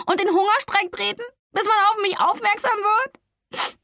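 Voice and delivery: sniffle through bitter tears; sniffling. A narrator's reading. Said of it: "schniefte Flori".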